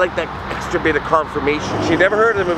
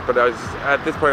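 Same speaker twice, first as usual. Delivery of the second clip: ordinary talk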